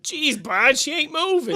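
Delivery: high, alarmed cartoon voice; Fred worried. Fred worries to Barney about Betty, high and alarmed. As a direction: fret over the body. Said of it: "you kept saying".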